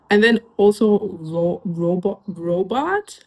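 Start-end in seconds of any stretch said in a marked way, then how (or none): none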